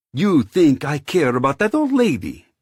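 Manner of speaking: high, loud scornful sneer. Acted. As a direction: sneer at the idea. Scornful retort.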